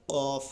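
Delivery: guttural qaf; throat qaf. Shown as a demonstration. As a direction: push the q of قاف from the throat. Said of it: "قاف ایسے کر کے حلق سے نکلے گا".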